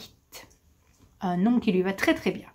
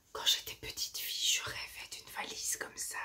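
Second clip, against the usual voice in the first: hushed confiding whisper; 13 dB quieter than usual. Real, not acted. Confiding a childhood dream.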